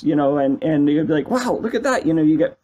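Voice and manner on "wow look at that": amazed. Retold or imitated. Kid amazed at modified game.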